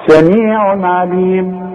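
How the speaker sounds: slow chanted سميع عليم, clean "un" on سميع; drawn-out سميع عليم, short clear n before the ع; clear tanween izhar. Shown as a demonstration. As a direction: recite سميع عليم with long vowels and no hum on the tanween. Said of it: "اسمه اظهار".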